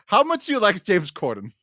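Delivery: usual speaking voice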